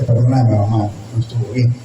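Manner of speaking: humble apology. Retold or imitated. Son apologizing to his mother.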